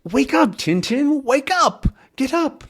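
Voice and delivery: high-pitched urgent dog voice; Snowy. Acted. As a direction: urge him awake in a very high voice. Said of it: "But Snowy goes".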